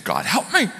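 ordinary talk, nothing marked

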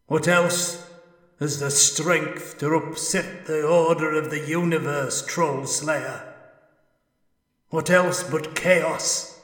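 high, loud, otherworldly ghost; an anguished spirit calling from beyond; ghostly spirit voice. Acted. Ghost answers the dwarf.

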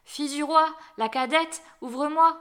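high, loud call in short phrases. A narrator's reading. Frog calling the princess from outside the door, calling out. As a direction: call through a closed door. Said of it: "on frappa à la porte et on appela".

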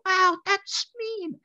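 very high, very slow falsetto; imitated street vendor. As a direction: stretch it out as a squeaky running-joke voice. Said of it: "We imitate that voice all the time".

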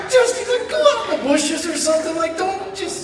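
very high, urgent pleading; exasperated mock squeal. Teller stops friend digging in pockets, high exasperated plea. Frantically stopping friend in public, retold.

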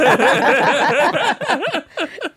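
loud high burst of laughter. Real, not acted. Host breaking up at the guest's strip club story, loud laugh. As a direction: crack up out loud.

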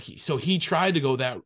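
ordinary delivery

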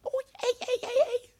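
put-on very high squeaky Manchester accent. Doing the only accent he knows from skits, Manchester. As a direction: put on a high-pitched Mancunian skit voice. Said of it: "You get Manchester".

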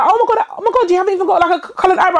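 high, gushing mockery; parodying people obsessed with her eyebrow, high and gushing; mock fussing people.

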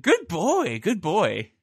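high, sing-song praise for a dog; pet praise voice. Acted. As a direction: coo at the pup like a good boy.

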